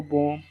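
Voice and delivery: "बॉम्ब" said as "bom", b dropped; silent final b. Shown as a demonstration. Teacher showing students the silent b, b dropped. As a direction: end "बॉम्ब" on the m. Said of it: "बी नहीं पढ़ेंगे".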